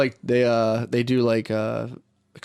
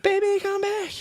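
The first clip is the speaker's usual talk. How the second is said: mock falsetto plea. Mock pleading ex.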